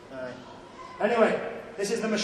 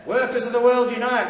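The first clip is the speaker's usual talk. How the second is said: loud, higher-pitched rallying cry; rousing slogan; 6 semitones higher, 9 dB louder than usual. Acted. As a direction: proclaim it to a crowd. Declaims a famous political slogan.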